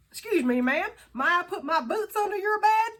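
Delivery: high, loud mock cowboy charm; flirty cowboy. Reader blurts a cowboy pickup line to a lady, hammed up. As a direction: tip your hat and ask her cheekily.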